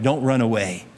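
ordinary talk

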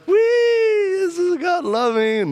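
hammed-up imitation of a blissful wife; joyful mock squeal. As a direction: squeal it out like a child on a water slide. Imitating wife swimming in love.

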